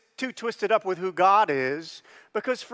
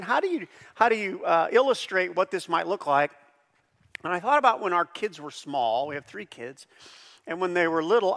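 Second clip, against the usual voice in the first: usual speaking voice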